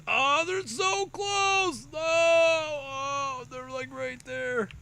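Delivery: high, drawn-out squeal of suspense; tense excitement. Real, not acted. Caster watching Banelings creep close, high drawn squeal. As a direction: stretch it out, dying of tension.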